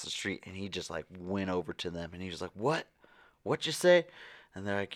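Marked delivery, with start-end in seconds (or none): 2.5-4.1 high, sharp, rising challenge; imitating a man squaring up for a fight; mock aggressive questioning; confrontational. Drunk man squaring up to couple.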